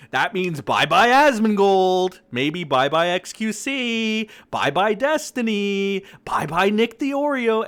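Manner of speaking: loud gleeful taunting, high; excited shout. Real, not acted. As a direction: sing out the goodbyes with glee. Gleeful ranting farewell list.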